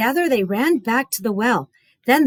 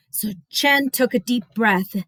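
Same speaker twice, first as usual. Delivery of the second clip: plain reading voice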